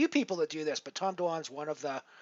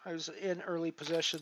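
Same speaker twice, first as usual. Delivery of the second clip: ordinary delivery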